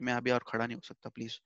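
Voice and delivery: a meek, begging plea that trails off; pleading please. Retold or imitated. Arjun begging Krishna to let him stop, soft and imploring. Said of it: "तो please type से ऐसे बोल रहा है अभी रोते-रोते".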